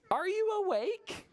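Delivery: very high, slow singsong call; rabbits calling. Acted. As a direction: call out like little storybook rabbits. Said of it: "Four racing rabbits call".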